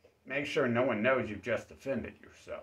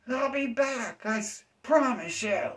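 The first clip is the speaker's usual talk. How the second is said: whiny vow repeated; 12 semitones higher than usual. Retold or imitated. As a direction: repeat the squeaky vow, less force. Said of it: "That person feels like".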